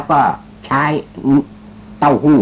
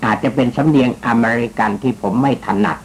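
ordinary talk

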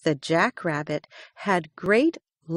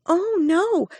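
little hare voice; 10 semitones higher, 3 dB louder than usual. A narrator's reading. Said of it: "said Little Hare".

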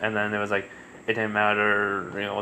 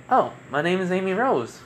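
put-on higher-pitched voice for the girl; lightly put-on girl's voice, pitched up; girl character; 8 semitones higher than usual. Girl introduces herself to a stranger, higher-pitched voice.

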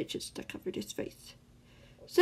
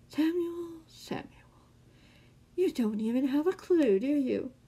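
taunting; 7 semitones higher than usual. A narrator's reading. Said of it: "the man taunted".

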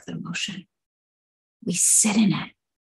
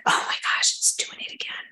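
soft anxious whisper; dreading whisper; 8 dB quieter than usual. Retold or imitated. Someone in pain whispering as it flares up again.

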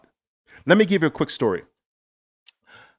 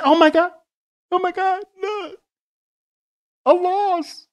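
much higher, hammed-up panicked wail; mock panic; 4 dB louder than usual. Mocking traders who panic over a losing trade. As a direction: shriek it like a scared kid.

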